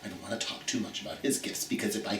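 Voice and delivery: hushed whisper so the dog won't hear. Real, not acted. Host whispering so her dog won't hear. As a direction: whisper it, keep the secret from the dog.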